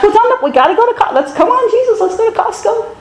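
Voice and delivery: high, whiny coaxing; mock pleading. Pastor playfully begging Jesus to join his errand, whiny. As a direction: wheedle Jesus like a bored kid. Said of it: "I'm just complaining".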